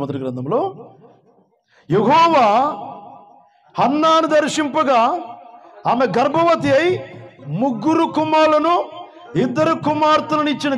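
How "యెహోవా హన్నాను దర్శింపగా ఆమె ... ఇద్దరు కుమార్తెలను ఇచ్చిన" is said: high and loud, slowed, with laughter breaking through; joyful preaching. Real, not acted. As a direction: read the miracle with delight. Joyful scripture reading in sermon.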